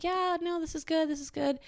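high, half-hearted agreement; imitating forced positivity; going along. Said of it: "kinda had to, like, go along with it".

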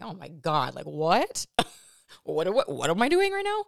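a flustered outburst, half laughing; mock panic. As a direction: replay the moment of alarm, hammed up with a laugh. Retelling her panic in the moment.

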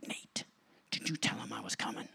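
hushed, breathless whisper to a friend; astonished church whisper; awed whisper. Retold or imitated. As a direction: lean in and whisper it in wonder.

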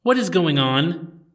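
commanding princess calling down the stairs; blasting demand. Acted. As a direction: stop everyone in their tracks. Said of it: "a new voice blasted out from above them".

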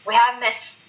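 ordinary talk, nothing marked

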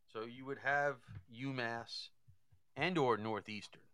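ordinary talk, nothing marked

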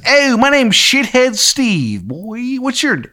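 put-on high, drawn-out, terrible Maryland accent; Shithead Steve's Maryland accent. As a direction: introduce yourself in a hammed-up Maryland twang. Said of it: "he's got, like, a terrible Maryland accent".

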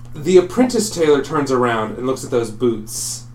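ordinary delivery

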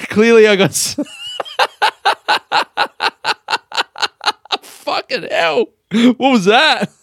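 high, breaking laughter through the words; laughing at himself. Real, not acted. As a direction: crack up at your own rant.

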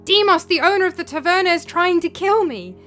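loud, high, panicked pleading; strained desperate cry. A narrator's reading. Philip calling to Demos in a dream for rescue, loud and panicked. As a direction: yell for help as if cornered. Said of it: "his voice was strained and rasping".

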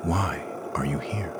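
unvoiced, hushed question; soft whisper of a question. A narrator's reading. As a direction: whisper it as the only question that matters. Moth's hushed key question.

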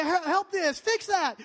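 high, loud frantic begging; desperate pleading. Retold or imitated. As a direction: cry out to God in a mock panic. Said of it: "Life is bad".

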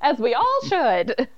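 high chirpy social media voice; sing-song post voice. Pretend. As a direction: gush it like a cheery post. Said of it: "and I'm like".

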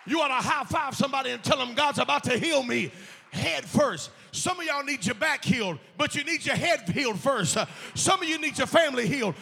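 loud, fervent sermon shouted to the church; full-force preaching. Acted.

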